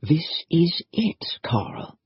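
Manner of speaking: breathless slow whisper; soft whisper. Acted. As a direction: hush it so nobody hears. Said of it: "talking softly".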